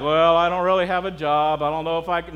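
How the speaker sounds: hammed-up hesitant excuse-maker; mock reluctance. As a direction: drag out a mock doubtful excuse.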